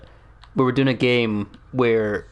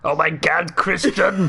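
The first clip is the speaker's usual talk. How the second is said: higher, strained action-star impression; Arnold Schwarzenegger; 6 semitones higher, slower than usual. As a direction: cry out in mock passion, Austrian accent. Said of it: "it was just Arnold Schwarzenegger instead".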